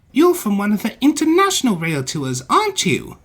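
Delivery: high, loud little engine voice; eager small engine. A narrator's reading. Percy quizzes the visiting engine, eager. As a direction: pipe the question up high.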